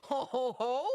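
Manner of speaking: hearty ho ho laugh. Real, not acted.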